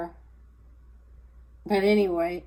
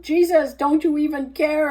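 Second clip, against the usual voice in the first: higher, louder desperate plea; pleading cry; 10 semitones higher, 6 dB louder than usual. Retold or imitated. Preacher imagining crying out to Jesus, loud high plea. Retelling his own complaint to God.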